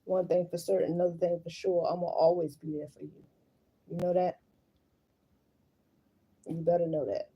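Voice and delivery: low, soft soothing voice; tender reassurance. Real, not acted. Mother promising to always be there, tender and hushed. Soothing own child after crying.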